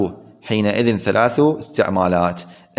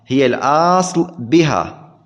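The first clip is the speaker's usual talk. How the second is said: ordinary talk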